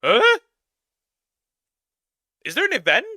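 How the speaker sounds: high loud disbelieving huh; shocked. Real, not acted. Streamer hears of a raid event, shocked and loud. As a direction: blurt out the surprise loudly.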